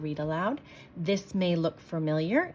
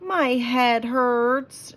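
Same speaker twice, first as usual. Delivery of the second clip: very slow, higher, louder moan; 6 semitones higher, 6 dB louder, much slower than usual. Acted. Sarah complaining in the kitchen, moaning. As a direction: drag out a pitiful moan. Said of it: "she moaned".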